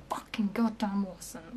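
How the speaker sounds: slow furious whisper; seething whisper. Real, not acted. Denying the missing email link, seething whisper. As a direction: hiss it under your breath.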